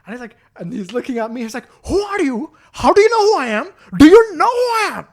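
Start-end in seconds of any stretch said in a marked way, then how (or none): 1.9-5.1 outraged customer. Retold or imitated. Customer demands recognition.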